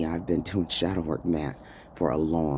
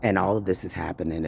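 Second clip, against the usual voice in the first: ordinary delivery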